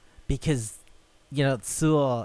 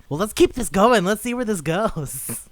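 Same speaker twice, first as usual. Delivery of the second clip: higher, hammed-up villain glee; gleeful villain; 9 semitones higher, 6 dB louder than usual. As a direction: play the villain relishing the game. Mimics villain gleefully stabbing on.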